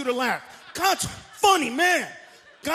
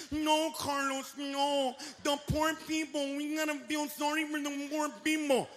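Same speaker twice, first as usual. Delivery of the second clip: softer slow whining; mock crybaby whine; 7 dB quieter than usual. Crybabies pleading for the poor, a soft slow whine. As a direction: whine it softly and slowly.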